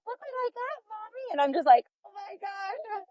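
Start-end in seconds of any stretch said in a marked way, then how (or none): 0.1-1.3 put-on high, soft, proud little kid; little boy voice. Mother voicing her little son. 2.0-3.0 hushed shock. Retold or imitated. Mother's shocked reaction to son.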